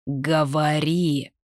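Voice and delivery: slow, drawn-out growl of a king; growled command. A narrator's reading. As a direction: growl the order, stretching the word. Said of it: "рыкнул король".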